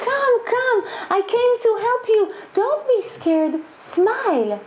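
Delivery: put-on high, gentle coaxing to a frightened boy; a hint of a soldier reassuring a hiding child.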